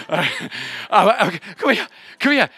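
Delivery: normal talking voice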